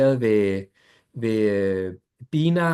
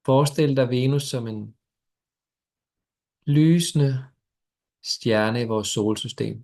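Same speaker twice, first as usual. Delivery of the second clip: guided meditation. Acted. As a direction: ease them gently into stillness.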